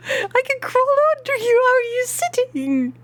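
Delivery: high cartoonish squeak at the character; squeaky high voice.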